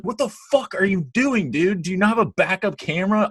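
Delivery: furious retold yell at the careless driver; retold screaming. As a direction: scream it at the driver who just hit you. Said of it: "I'm, like, screaming".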